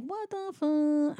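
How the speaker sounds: soft, slow, high disbelief; mock bewilderment. Reacting to being told a rat cooks, mock bewilderment. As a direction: draw it out quietly, dumbfounded. Imagined reaction to absurd claim.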